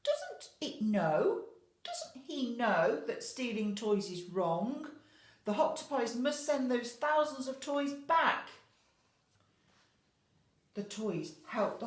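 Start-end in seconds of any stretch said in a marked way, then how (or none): none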